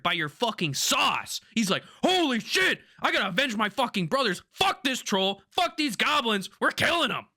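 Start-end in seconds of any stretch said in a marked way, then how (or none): 2.0-7.3 high, hyped-up battle cry; rally the table with heated, pitched-up outrage; fired-up vengeance. Retold or imitated. Player inspired by the DM to avenge his brothers, fired up.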